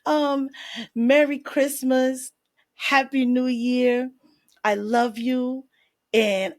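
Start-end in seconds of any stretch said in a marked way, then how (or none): none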